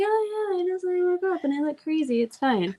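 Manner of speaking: high, quick mock-casual "it's fine"; breezy brush-off. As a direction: toss it off like nothing's wrong. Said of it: "I'm like".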